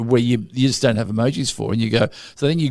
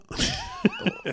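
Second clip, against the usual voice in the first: high laugh; 9 dB quieter than usual. Real, not acted. Laughing mid-story.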